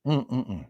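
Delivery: quiet hum of enjoyment after a sip; savoring hum. Real, not acted. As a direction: hum softly like the coffee's just right. Sipping coffee.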